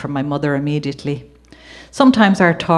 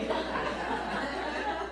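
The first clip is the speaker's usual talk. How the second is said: breathy laugh; 12 semitones higher, 16 dB quieter than usual. Real, not acted. Laughing at her own story.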